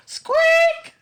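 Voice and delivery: very high, loud squeak; mouse squeak. A narrator's reading. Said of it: "said Mouse".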